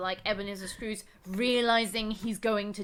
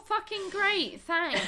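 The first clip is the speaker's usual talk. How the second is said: higher-pitched sarcastic thanks; mock-grateful sarcasm; 7 semitones higher, slower than usual. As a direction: say it with pointed fake gratitude. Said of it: "And you're like".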